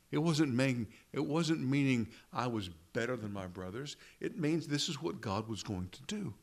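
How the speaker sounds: ordinary talk, nothing marked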